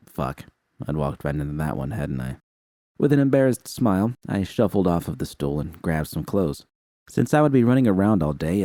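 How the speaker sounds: usual speaking voice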